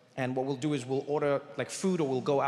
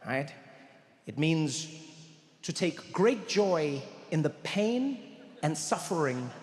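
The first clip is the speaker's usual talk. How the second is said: ordinary talk, nothing marked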